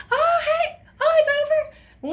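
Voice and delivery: very high, loud, drawn-out squeal; cousin's high-pitched greeting. Retold or imitated. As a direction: mimic her in a squeaky high voice. Imitates cousin walking into room.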